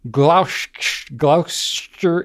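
Gloucester sounded out slowly as GLOW-ster; spelling-based pronunciation. Shown as a demonstration. Host reading an English county off the page, halting pronunciation. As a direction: stumble through "Gloucester" as it is spelt, drawn out. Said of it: "is what it looks like".

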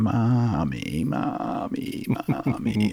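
put-on high, drawn-out childish whine; a sick kid whining for his mother from the top bunk. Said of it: "I'm whining".

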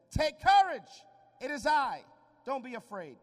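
high, loud call of take courage; Jesus calling out to frightened disciples; commanding reassurance. Acted. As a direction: call over the storm to calm them.

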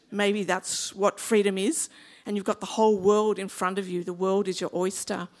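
ordinary delivery

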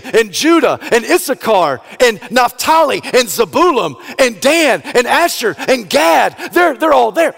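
building from normal to high, loud shouts; rising excitement. Acted. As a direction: let the joy climb name by name. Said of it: "he thinks to himself".

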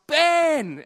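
loud, high call of a name. Retold or imitated. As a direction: hail the grandson from ten meters off, delighted. Grandpa calling out from afar.

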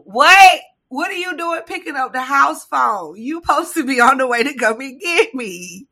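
mock-exasperated scolding; exasperated kid. Stranded kid scolding grandma on the phone, exasperated.

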